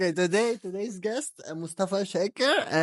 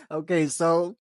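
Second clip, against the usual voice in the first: usual speaking voice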